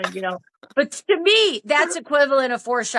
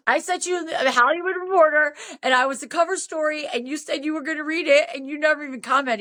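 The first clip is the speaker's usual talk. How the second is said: higher-pitched, fast retold complaint; hurt indignation, rattled off; going off; 5 semitones higher, faster than usual. Woman reproaching Dan over unread cover story, indignant. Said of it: "I went off on him".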